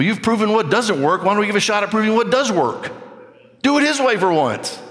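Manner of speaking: high-pitched, emphatic preaching; impassioned preaching. Real, not acted. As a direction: preach it high and insistent, stretching key words. Preaches at full force.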